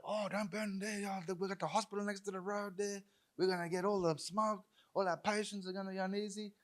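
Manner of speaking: high-pitched imitation of fretting residents; worried locals. Hospital neighbours objecting to the burn, imitated high worried voice. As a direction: put on a high, complaining voice. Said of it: "all the people were like".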